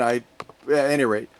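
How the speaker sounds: ordinary talk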